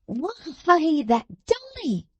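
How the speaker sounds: "Dolly" hit sharp, high and drawn out; the maid sneering the name "Dolly" high and slow; sharp emphasis on Dolly. A narrator's reading. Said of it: "with extremely sharp emphasis on the name".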